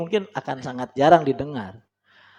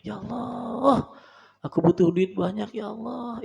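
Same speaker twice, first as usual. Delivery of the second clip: whiny begging prayer; 8 semitones higher, 4 dB quieter than usual. Shown as a demonstration. Preacher mocking a needy prayer, high pleading whine. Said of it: "masih lama kayaknya itu".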